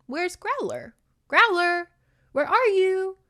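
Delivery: put-on very high little-kid voice calling out loud; a toddler calling for his lost puppy.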